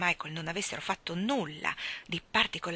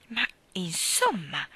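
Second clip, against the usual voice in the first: exclaimed; slower than usual. Acted. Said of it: "esclamò Mary Poppins".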